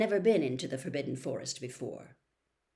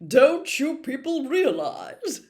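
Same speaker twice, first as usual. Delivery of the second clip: loud, higher, slow as if to dim pupils; slow and condescending; 8 semitones higher, 10 dB louder, slower than usual. A narrator's reading. Lockhart lecturing the class as if they were dim, slow and loud. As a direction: spell it out for the slow ones. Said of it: "speaking slowly, as though they were all a bit dim".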